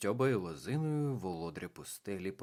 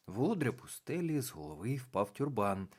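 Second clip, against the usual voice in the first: ordinary delivery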